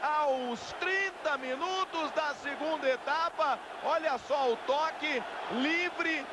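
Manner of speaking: huge, stretched high goal call; explosive goal commentary. Real, not acted. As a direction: scream the goal, long and high. Goal scored, narrator erupts.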